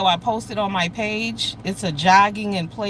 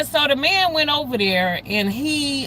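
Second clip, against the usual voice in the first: ordinary talk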